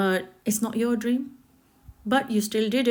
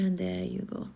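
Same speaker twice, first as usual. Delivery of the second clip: normal talking voice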